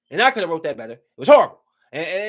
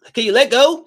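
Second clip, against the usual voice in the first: high, loud panicked plea; a scared little boy crying out; frightened kid; 10 semitones higher, 9 dB louder than usual. Retold or imitated. Boy being dragged under, begging to be let go.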